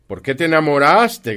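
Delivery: very loud, high-pitched grumpy outburst; growled disbelief. A narrator's reading. Scrooge scoffs at his nephew falling in love, loud and grumpy. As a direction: blurt it out in loud, cross disbelief. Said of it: "gruñó Scrooge".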